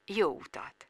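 very soft, suave phone voice; gentlemanly nonchalance. A narrator's reading. Actor arranges a Rome meeting by message, nonchalant. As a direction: understate it, quietly trailing off. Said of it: "úri, mély, nem törődöm hang".

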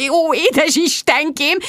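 slow, high, swooning exclamation; overcome excitement. A narrator's reading. Friend reacts to the star's message, overwhelmed. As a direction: gush in rapture.

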